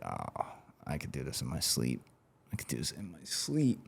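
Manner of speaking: muttered thought. Retold or imitated. Retold private thought, muttered.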